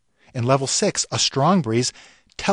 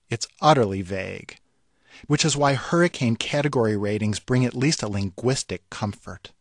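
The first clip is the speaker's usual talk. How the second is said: usual speaking voice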